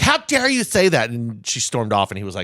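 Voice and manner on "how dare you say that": high, louder indignant cry; outraged Swiftie. Retold or imitated. Retelling the fan's outrage at the coworker, high and loud. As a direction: gasp it out, scandalised. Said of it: "And she's like".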